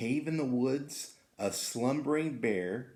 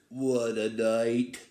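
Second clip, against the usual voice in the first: slow mole voice, a bit louder; muttering mole; 4 dB louder, slower than usual. Acted. Mole arriving in the storm, slow and gruff. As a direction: grumble it out slowly like a mole. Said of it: "Mole mutters".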